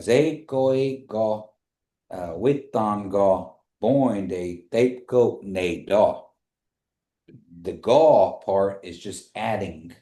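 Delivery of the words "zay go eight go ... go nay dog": slow, careful Kiowa answer; slow model answer. Shown as a demonstration. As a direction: spell out "Zay go eight go" word by word, slowly. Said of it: "So you could say, like".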